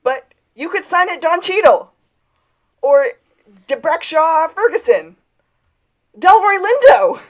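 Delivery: high, loud imitated shout; calling out. Sean yelling after Gus as he walks away, imitated shouting. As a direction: holler each suggestion after someone leaving.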